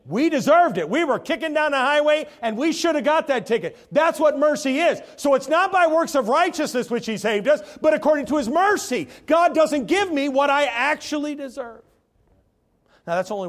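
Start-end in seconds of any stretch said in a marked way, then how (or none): 0.1-11.8 loud, high preacher's cry; fervent revival preaching. Acted. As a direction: drive the point home with full force. Preaching at full force.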